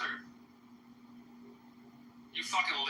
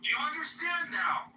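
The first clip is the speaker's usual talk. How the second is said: angry shout; 7 semitones higher than usual. Real, not acted. User screaming at a rival in a voice chat room, angry shout. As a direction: yell it high and hard.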